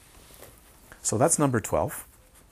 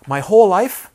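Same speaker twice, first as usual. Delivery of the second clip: much higher and louder, indignant cry; angry outburst; 8 semitones higher, 13 dB louder than usual. Retold or imitated. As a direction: shout it out in outrage at lost years. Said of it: "She was mad".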